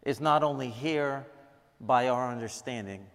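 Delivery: soft, reverent prayer voice. Acted. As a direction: pray quietly to God for the room. Opening prayer before sermon.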